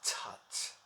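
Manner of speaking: soft, scornful little tut; dismissive tut. Acted. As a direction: brush it aside under your voice. Dismisses the obstacle in soliloquy.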